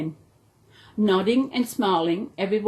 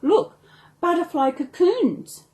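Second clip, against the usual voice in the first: excited; 8 semitones higher, 3 dB louder than usual. Acted. Excited discovery.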